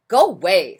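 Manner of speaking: loud grumpy shove-off; grouchy ladybug. Acted. Grouchy ladybug shooing the friendly one, loud and gruff. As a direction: bark it out like a cranky bug.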